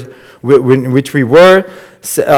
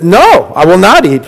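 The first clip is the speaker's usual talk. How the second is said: loud, high refusal; indignant refusal; 5 semitones higher, 5 dB louder than usual. Retold or imitated. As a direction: push it away, louder and higher. Peter refusing, acted quote.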